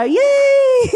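high squeal of yay; joyful cheer. Real, not acted. Woman cheering her healing to the congregation, squealing high. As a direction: cheer with delight.

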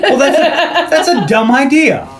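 worked-up mock outrage; incredulous objection. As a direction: shoot the pitch up in comic disbelief. Mocks the swear jar idea.

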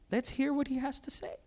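soft, high king's voice; mock king. Preacher playing King Ahab to the crowd, lightly mocking.

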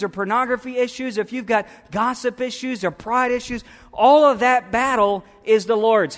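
impassioned preacher building in volume; preaching at full force. Real, not acted. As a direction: proclaim it forcefully to the congregation.

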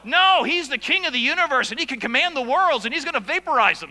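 loud, fast, high-pitched thundering; booming preaching. Real, not acted. Preacher roars to congregation about the king of the universe. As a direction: hammer it home with full force.

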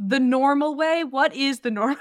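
loud, high, disbelieving question; incredulous challenge. Real, not acted. Host challenges co-host on how Cockney is written, incredulous challenge. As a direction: throw the phrase back in disbelief.

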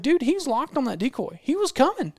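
high, emphatic arguing; retold exasperated insistence. Guide telling the hunter the bird was committed, high exasperated insistence. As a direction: insist it, pitched right up.

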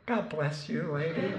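a fond, chuckling blessing; grateful relieved student. Retold or imitated. Relieved freshman thanks his teacher, high and laughing. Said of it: "And I was like".

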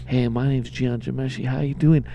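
low, soft pickup line; smooth seducer voice. Retold or imitated. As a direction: purr it like a sleazy charmer. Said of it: "he's like".

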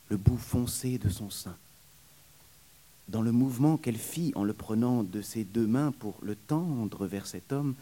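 plain reading voice

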